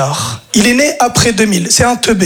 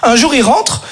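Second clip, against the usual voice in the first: ordinary talk, nothing marked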